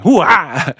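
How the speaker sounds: mock hip-hop holler. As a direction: punch it out as a hype exclamation. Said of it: "he a Kazakhstan [name]".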